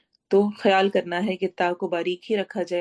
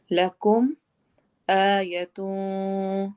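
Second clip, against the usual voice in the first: ordinary talk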